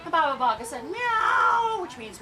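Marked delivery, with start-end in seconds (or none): 0.8-1.8 high, loud, drawn-out meow; big pleading meow; witch speaking cat. Acted. Witch asking the cat for her eye back, high meow. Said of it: "so she could speak cat".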